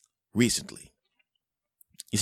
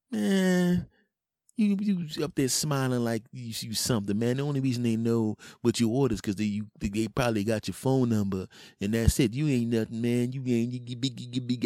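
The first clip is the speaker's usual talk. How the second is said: higher-pitched mock grumbling; jealous friend; 6 semitones higher than usual. Jealous friend putting him down, sputtering higher voice. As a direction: stumble into tongue-tied mock indignation.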